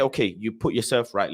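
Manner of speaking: usual speaking voice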